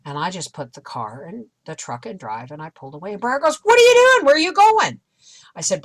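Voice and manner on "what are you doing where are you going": imitating a startled son, high and loud; alarmed protest. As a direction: cry out as mom drives off. Voices son's startled protest.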